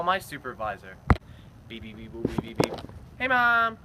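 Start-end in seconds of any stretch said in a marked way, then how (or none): 1.7-2.7 low mouth beeping like dialing; phone beeps. Acted. 3.2-3.8 calling out. Acted. Calling mom on phone.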